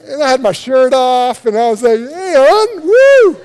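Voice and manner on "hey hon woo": high, loud hammed-up come-on to his wife; mock flirty holler. Husband hams up a shirtless come-on to his crying wife, mock flirty holler. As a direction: play the cheesy seducer, whoop it up.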